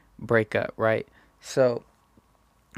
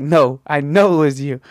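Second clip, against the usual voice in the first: louder, flat certainty; she's sure, no doubt; firm accusation; 2 semitones higher, 7 dB louder than usual. Retold or imitated. Voicing Hillary's certainty.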